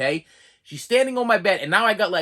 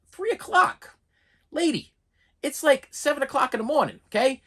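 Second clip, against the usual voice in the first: much higher, incredulous three o'clock; outraged disbelief; 9 semitones higher than usual. Retold or imitated. Patient to the nurse about the delayed scan, much higher in disbelief. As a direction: squeal it out in disbelief.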